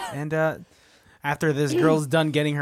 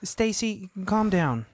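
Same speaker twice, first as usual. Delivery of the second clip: acted quarrel; 6 semitones higher than usual. Improv scene, vacation quarrel.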